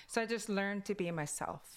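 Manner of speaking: ordinary delivery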